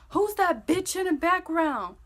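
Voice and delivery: high, loud imitation of irritated boyfriend; indignant mock outburst; angry demand. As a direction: mimic him snapping a question. Boyfriend demands, mid-quarrel.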